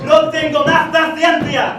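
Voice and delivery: mock angry shout. Spaniard losing his temper with the servant, mock angry shout. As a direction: shout in a Spanish accent, out of patience.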